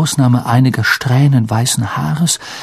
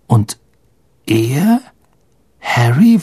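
ordinary delivery, nothing marked

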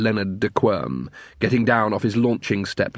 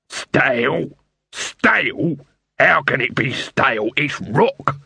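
indignant shout; slower than usual. Acted. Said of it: "shouted Dibbler after them".

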